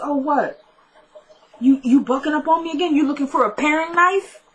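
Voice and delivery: high, challenging retold threat; threatening mother. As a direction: act out a mother daring her son to step up. Retold mother threatening her son.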